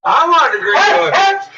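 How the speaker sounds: voicing the dog begging for butter, high and whiny. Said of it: "She's like".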